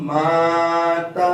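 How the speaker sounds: माता drawn out slowly; Quranic recitation. Shown as a demonstration. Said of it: "आप कैसे पढ़ेंगे?".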